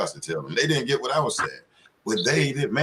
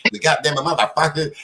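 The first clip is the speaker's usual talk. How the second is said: mock cussing out; 4 semitones higher, 4 dB louder than usual. Shown as a demonstration. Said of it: "go in your job with that".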